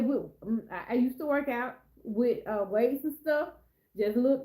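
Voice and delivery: normal talking voice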